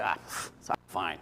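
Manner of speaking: a dismissive scoff, shrugging it off; mock tough guy waving the worry away; tough-guy brush-off. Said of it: "be a tough guy".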